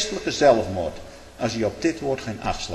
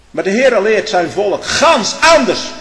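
slow, high, loud pulpit cry; fiery preacher's climax; preaching at full force; 9 semitones higher, 11 dB louder, slower than usual. Acted. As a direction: thunder it out to the congregation. Preaching climax, much louder.

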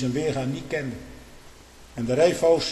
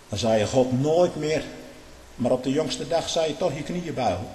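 usual speaking voice

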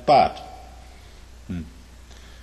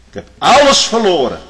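exclaimed; 8 semitones higher, 12 dB louder than usual. Acted. Sudden outburst in sermon.

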